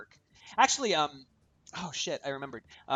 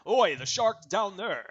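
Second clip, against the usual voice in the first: put-on Aussie accent; 4 semitones higher, 6 dB louder than usual. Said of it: "I'm terrible at Aussie accents".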